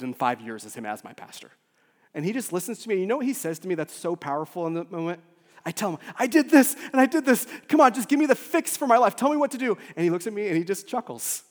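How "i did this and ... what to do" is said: loud, high-pitched frantic venting; exasperated outburst, pitched way up; desperate pleading. Retold or imitated. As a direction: plead at full voice for a quick fix. Acts out desperate plea to pastor.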